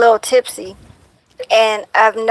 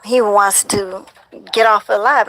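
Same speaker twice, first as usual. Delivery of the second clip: normal talking voice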